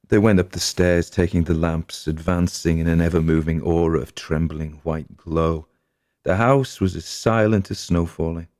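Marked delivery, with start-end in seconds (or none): none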